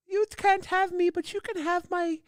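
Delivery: very high falsetto French lady; mock French woman dubbing; French woman accent. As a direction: play a silly Frenchwoman in falsetto. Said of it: "My French woman accent?".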